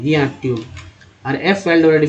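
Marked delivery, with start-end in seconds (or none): 0.4-0.6 ट्यून with an ई-यू glide; ट्यून said with a "yoo" vowel; word pronunciation demo. Shown as a demonstration. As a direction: model ट्यून as a single word. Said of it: "ई यू के रूप में".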